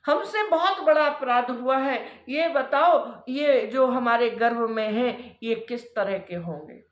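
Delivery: lightly voiced pleading wife, pitch lifted in waves; pleading Diti. Wife confessing to her sage husband, anxious pleading.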